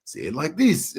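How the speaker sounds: New Zealand lilt, rising at the end; Kiwi accent. Retold or imitated. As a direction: imitate a Kiwi coach feeding lines. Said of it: "in, in a Kiwi accent".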